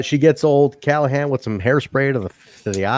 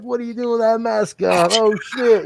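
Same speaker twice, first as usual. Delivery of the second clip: mock wrestler banter; 9 semitones higher than usual. Wrestlers mocking the mask.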